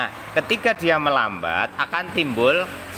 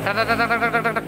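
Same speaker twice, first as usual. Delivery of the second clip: higher rapid tek tek ticks; fast imitated ticking; 6 semitones higher, faster than usual. Shown as a demonstration. Mechanic imitating faster ticking at high revs, higher tek sounds. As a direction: rattle off ticks like a revving engine. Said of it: "ketukan suaranya makin banyak".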